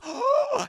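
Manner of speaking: high, loud mock gasp; sharp acted intake of breath; horrified gasp. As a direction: gasp at what is behind her. Child sees attacker behind.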